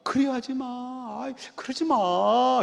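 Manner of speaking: a doting father softly begging his sons; soft pleading father. Retold or imitated. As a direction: beg gently like an indulgent old father. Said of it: "이랬다는 거예요".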